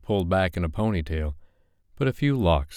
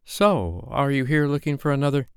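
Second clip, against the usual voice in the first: higher, curious friend's voice; lightly voiced friend; 8 semitones higher than usual. A narrator's reading. Tara asking Sophie if she's looking for a new partner, curious.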